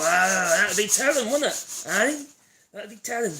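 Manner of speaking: loud, high-pitched teasing; playfully coy. Pretend. Streamer to viewer asking about his accent, playfully coy. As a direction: dodge the question with mock secrecy, loudly.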